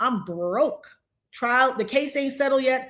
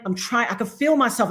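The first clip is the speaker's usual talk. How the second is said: ordinary delivery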